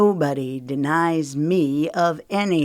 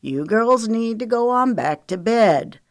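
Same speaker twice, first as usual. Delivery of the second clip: higher, easy drawling command; easy authority; 6 semitones higher, 3 dB louder than usual. A narrator's reading. Wiley dismisses the girls, easy authority. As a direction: send them off with casual authority. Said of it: "said in an easy tone of authority".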